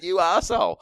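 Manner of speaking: high loud mock outrage; hammed-up betrayed yelling; mock indignant fans. Shattered fans yelling at the speaker, mock outraged. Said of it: "they said".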